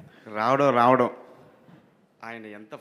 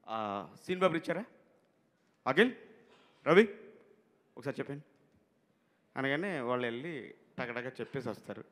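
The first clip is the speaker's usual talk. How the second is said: ordinary talk, nothing marked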